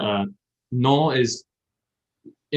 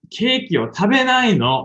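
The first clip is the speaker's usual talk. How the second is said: much higher, slow, scolding woman's voice; reprimanding woman; 11 semitones higher, 6 dB louder than usual. Shown as a demonstration. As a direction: scold like a girlfriend stopping him eating cake. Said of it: "the woman go".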